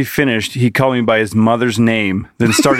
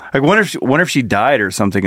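usual speaking voice

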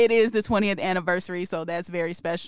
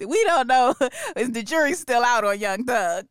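laughing; 3 semitones higher, 4 dB louder than usual. Real, not acted. Laughing at [name] case.

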